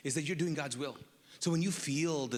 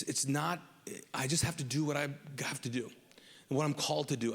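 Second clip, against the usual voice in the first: ordinary talk, nothing marked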